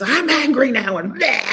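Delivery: hammed-up tantrum at loved ones; mock outburst. Host mocking someone dumping anger on family, laughing shout. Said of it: "take all your feelings out on other people".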